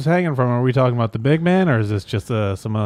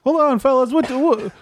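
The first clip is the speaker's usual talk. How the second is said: high-pitched interrupting Jesus; inquisitive comic Jesus; 13 semitones higher than usual. Acted. Jesus asks visitors for a name, high-pitched and quizzical. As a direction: cut in with a raised, quizzical voice.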